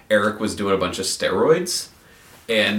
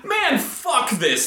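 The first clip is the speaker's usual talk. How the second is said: high, drawn-out mock disgust; exasperated surrender; 12 semitones higher, much slower than usual. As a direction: retell a beaten guy giving up angrily. Stranger finally gives up, retold.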